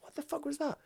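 high, breathy bewilderment; bewildered. Retold or imitated. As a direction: ask it stunned, half under your breath. Retold bewilderment after he ran.

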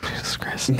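muttered under the breath; whisper. Real, not acted. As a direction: whisper it low into the mic. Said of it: "Don't whisper into the mic".

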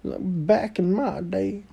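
lightly voiced old man tree. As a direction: grumble it like a grandpa. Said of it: "see their grandparent trees".

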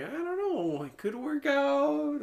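high-pitched, hesitant retelling; high unsure voice. Retold or imitated. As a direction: squeak out your doubt. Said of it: "I was like".

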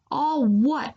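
a quick irritated snap; snapping. A narrator's reading. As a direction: snap it back at them. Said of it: "you snap".

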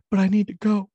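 high, loud anguished cry; hammed-up tearful farewell; dramatic wail. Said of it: "she's like".

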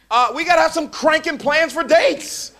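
rally them up; fired up. Real, not acted. Preacher urging young disciples about dates, fired up.

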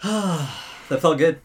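slow, sighing contentment; satisfied sigh. Real, not acted. Guest winding down at the end of the show, content sigh. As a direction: breathe it out, relieved. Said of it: "sigh of relief".

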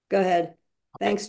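normal talking voice

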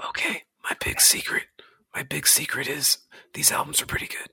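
playful confiding whisper. Pretend. Host confiding his big secret to co-host, playful whisper. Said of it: "keep your voice down".

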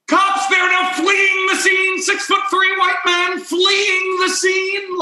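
high, drawn-out hammed-up shout; mock yelling. Uptight man reporting a getaway to police, mock shouting. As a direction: imitate a frantic caller raising the alarm, stretching the words. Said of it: "the man is yelling into the micro".

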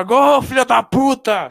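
loud, high, stretched-out football cheer; goal celebration yell. Retold or imitated. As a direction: roar it like a fan celebrating a goal. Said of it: "só faltou gritar". (gritando gol como torcedor)

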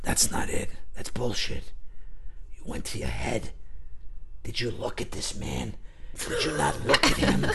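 low, hushed rebuke; menacing near-whisper; stern acting teacher. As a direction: imitate a coach quietly dressing down a student. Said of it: "Bob would go".

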